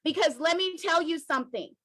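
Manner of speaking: normal talking voice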